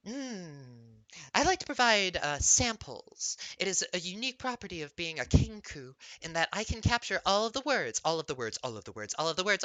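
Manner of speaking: a bird-like mimic, stuttering echoes of her own words; Kenku mimic voice. Kenku bard tells the player about her music, echoing words. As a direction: play the Kenku who parrots and repeats.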